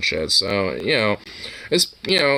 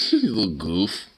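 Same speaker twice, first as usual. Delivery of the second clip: talking to a dog; 5 dB quieter than usual. Real, not acted. Soft cooing at pet.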